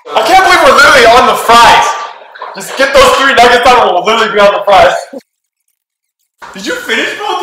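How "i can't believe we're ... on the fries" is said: excited. Real, not acted. Excited near the challenge's end.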